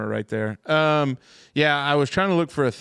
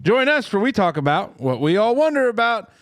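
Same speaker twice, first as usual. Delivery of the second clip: announcer intro; 6 semitones higher than usual. Acted. Podcast intro announcer read.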